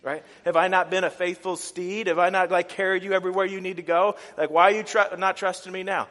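mildly hammed-up reproach to a master; lightly voiced indignant donkey. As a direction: act out a faithful steed's complaint. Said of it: "the donkey turns to him and is like".

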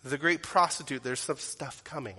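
usual speaking voice

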